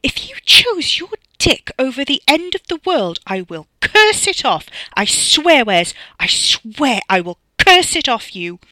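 loud, strident threat; furious high-pitched tirade; riled. A narrator's reading. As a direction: swear vengeance, hitting the key words hard. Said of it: "very hard to get rid of when she was riled".

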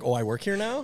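high, startled mock disbelief; mock surprise. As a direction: sound suddenly confused and taken aback. Surprise on entering staff area.

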